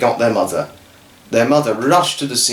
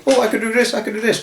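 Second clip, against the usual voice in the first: excited boast; 10 semitones higher, much faster than usual. Acted. Emmett's boast mid-air.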